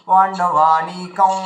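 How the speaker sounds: পাণ্ডবানিকং with the va pulled up into the nose and held; slowly nasalise the va of পাণ্ডবানিকং; teacher showing the nasal va; nasalised va. Shown as a demonstration. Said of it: "চন্দ্রবিন্দু লাগিয়ে নিচে হলন্ত দিয়ে উচ্চারণ".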